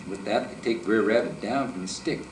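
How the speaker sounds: plain reading voice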